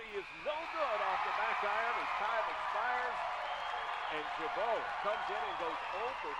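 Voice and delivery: loud, high burst of buzzer-beater calls; excited shout. Real, not acted. Radio announcer calling the tying shot at the buzzer, shouting excitedly. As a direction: ride the final seconds at full pitch.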